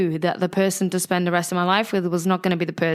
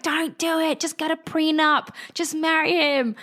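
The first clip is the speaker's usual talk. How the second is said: high, mock-tearful father begging; pleading dad; 9 semitones higher than usual. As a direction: beg like a dad losing his pension. Crying father retold on phone.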